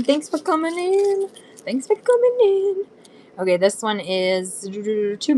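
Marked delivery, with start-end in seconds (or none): 0.0-1.3 high, slow sing-song welcome; sweet shopkeeper voice; cute high greeting. Pretend. 1.6-2.8 even higher sing-song welcome; sweet, squeaky hello to viewers; cute high greeting. Pretend.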